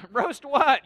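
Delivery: high, loud incredulous question; baffled outburst. Acted. Baffled friend answering a coffee snob, loudly incredulous. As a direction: burst out in mock disbelief.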